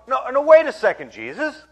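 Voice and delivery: indignant, emphatic protest; challenging crowd. Acted. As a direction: voice a doubting crowd calling out his lack of credentials. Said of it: "they're thinking to themselves".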